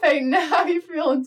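put-on Southern-accented show opening. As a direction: play up a Southern twang, loud and cheery. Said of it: "I should talk in a Southern accent".

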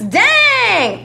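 high, drawn-out whoop; excited exclamation. Real, not acted. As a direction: let it burst out with delight. Excited about Juanes giveaway.

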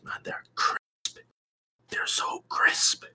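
hushed, breathy whisper in awe. Real, not acted. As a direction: whisper it slowly, savouring it. Savouring the animation quality.

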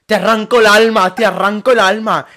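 loud, high imitation of a crazed threat; retold threat. Creepy man on the metro threatening to rip out her soul, loud mock menace. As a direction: shout it like an unhinged stranger.

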